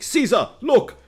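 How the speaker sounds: high, loud startled cry; excited ancient man. Retold or imitated. Ancient man shouting to Caesar, high and loud. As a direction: call out in amazed alarm. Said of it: "some dude saw... and was like".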